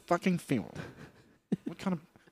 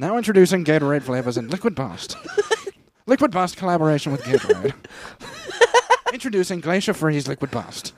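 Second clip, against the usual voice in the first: louder hyped-up ad read, laughing; mock announcer; 4 dB louder than usual. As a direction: announce the new flavor like a commercial. Mock product launch announcement.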